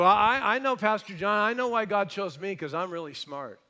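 high, loud, smug boast; boastful listener. Retold or imitated. Smug churchgoer bragging to Pastor John, high and loud. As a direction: brag like a know-it-all. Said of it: "You go".

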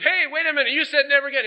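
high, whiny mock protest; indignant protest. Man protesting to God in a high, indignant voice. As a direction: complain to God in a high put-out voice. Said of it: "Man says".

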